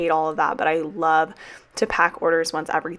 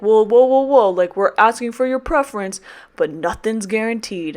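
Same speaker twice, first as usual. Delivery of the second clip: louder, higher "whoa" backpedal; mock backpedaling school; 4 semitones higher, 4 dB louder than usual. The school retracting its promise to teachers, mock backpedal. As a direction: mimic the school hitting the brakes. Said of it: "we get emails that's like".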